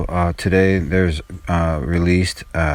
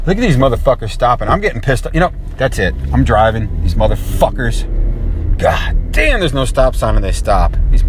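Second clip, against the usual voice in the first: annoyed at drivers; 6 semitones higher than usual. Real, not acted. Angry at cars stopping while driving.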